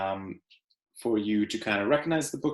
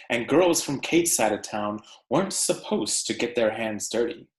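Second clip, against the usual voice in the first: story read aloud; 3 semitones higher than usual. Acted. Teacher reading The Tree Lady to her class, expressive read-aloud. Reads picture book to class.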